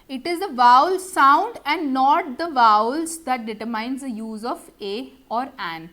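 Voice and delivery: very slow, deliberate dictation; slow rule reading. Shown as a demonstration. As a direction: read the rule out word by word. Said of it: "rule read slowly".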